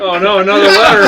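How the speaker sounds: high, loud laughing words. Real, not acted. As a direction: say it while cracking up. Laughing through a joke.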